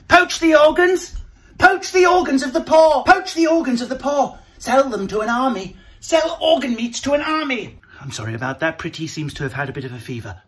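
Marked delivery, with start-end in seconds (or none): 0.1-7.8 villain rant. Acted. Mock villain rant about organs.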